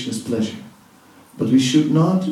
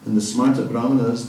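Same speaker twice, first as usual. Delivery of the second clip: normal talking voice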